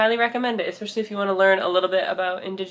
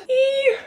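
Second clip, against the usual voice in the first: excited squeal; 5 dB louder than usual. Real, not acted. Excited squeal after plans.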